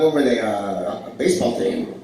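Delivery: usual speaking voice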